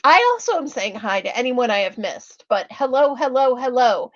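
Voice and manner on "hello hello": ordinary delivery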